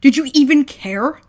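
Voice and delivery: loud, mocking, rising sneer; sarcastic older brother building to a shout. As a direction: taunt him harder each line. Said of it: "voice deep and sarcastic".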